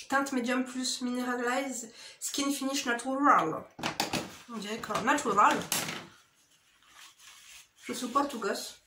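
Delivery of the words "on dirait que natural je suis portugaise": usual speaking voice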